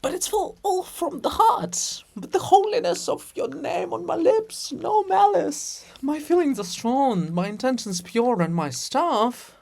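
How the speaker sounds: hammed-up high courtly plea; pleading suitor. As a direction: overplay the devoted lover, high and pleading. Andrew pleads his devotion.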